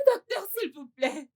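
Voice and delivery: begging through tears; crying plea. Acted. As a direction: plead with the doctor, voice full of crying. Mother begging doctor for son. (une mère supplie le docteur de sauver son fils, en pleurant)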